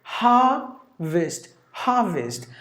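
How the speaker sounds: "Harvest" with no R, stressed on "Har"; British, first-syllable stress. Shown as a demonstration. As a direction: say "Harvest" as HAH-vist, British-style. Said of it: "get the stress for the first syllable".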